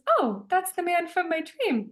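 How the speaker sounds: high, lightly voiced friend; a casual high friend voice; a hint of a breezy friend; friend's reply.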